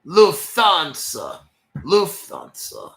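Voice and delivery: put-on drawn-out comic German accent; mock German accent. Host making fun of Germans with an airline name, mock German accent. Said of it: "my Russian and German accent when I make fun".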